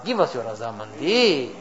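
ordinary talk

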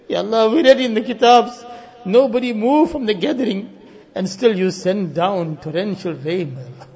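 ordinary talk, nothing marked

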